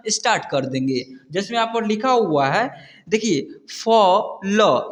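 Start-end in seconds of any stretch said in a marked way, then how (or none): 3.7-4.9 slow, stretched word for pupils; drawn-out reading demo. Shown as a demonstration. Teacher reading a word to children, drawn out slowly. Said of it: "इसे पढ़ेंगे फॉल".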